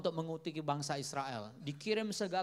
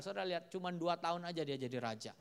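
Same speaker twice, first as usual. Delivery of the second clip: normal talking voice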